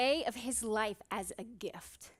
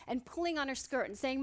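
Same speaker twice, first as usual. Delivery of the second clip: normal talking voice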